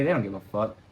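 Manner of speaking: usual speaking voice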